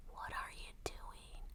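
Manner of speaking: barely audible, slow whisper; fainter mock whisper. Podcaster mimicking Paula, trailing off into a faint whisper. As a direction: let it fade almost to nothing. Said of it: "So she talks".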